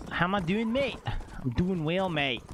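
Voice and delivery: playful put-on Australian accent. Streamer bantering with chat as an Australian, playful put-on accent. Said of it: "why are we Australian today".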